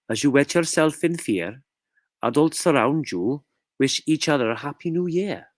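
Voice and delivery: storytelling in a put-on Scottish accent. Shown as a demonstration. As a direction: read the tale with a wandering Scottish accent. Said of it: "I did go from Scot-, uh, Welsh to Scottish".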